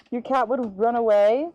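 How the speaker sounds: normal talking voice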